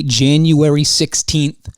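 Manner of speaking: ordinary talk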